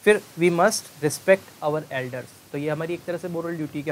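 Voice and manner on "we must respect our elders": very slow example read; slow dictation. Shown as a demonstration. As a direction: drag each word out for the class. Teacher reads example sentence.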